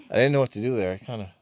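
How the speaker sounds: ordinary delivery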